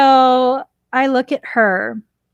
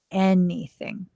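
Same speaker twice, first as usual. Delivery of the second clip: normal talking voice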